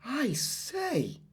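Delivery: high hushed astonished gasp. Acted. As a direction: breathe it in surprise. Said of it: "gasped Robert".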